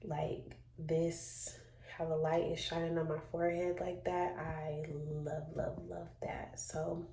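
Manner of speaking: soft near-whisper with babies asleep; quiet. Real, not acted. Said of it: "I'm gonna be kinda quiet because my babies are sleeping".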